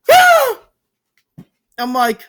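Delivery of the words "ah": short, high, loud imitated scream; mock audience scream. Vlogger mimicking people at live audience reactions, mock scream. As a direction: let out a sudden high "Ah!". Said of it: "sitting there like".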